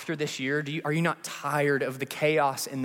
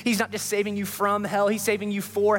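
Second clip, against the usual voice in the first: preaching at full force, higher and building over swelling music; rising preaching; 7 semitones higher than usual. Acted. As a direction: build the altar-call cadence line by line.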